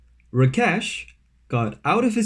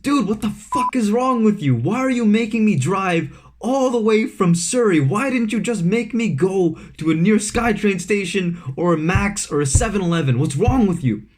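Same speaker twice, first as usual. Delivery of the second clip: higher, mock furious ranting; angry rant; 6 semitones higher than usual. Angry friend ranting at the prankster, mock yelling. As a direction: imitate the friend yelling down the phone. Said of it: "starts going off on me".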